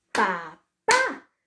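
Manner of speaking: Papá in two beats, final PÁ loud; low first PA, then punch the PÁ of Papá; stress on last syllable. Shown as a demonstration. Teacher showing the tonic syllable, Papá with a forceful final PÁ. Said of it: "tenía mayor fuerza de voz".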